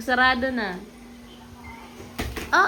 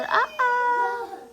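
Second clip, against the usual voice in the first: dinosaur voice; 9 semitones higher, 4 dB quieter than usual. Pretend. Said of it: "Sabi ni dinosaur".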